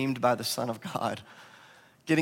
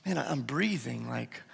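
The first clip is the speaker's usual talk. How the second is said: quiet wonder; 2 semitones lower, 7 dB quieter than usual. Retold or imitated. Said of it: "you thought".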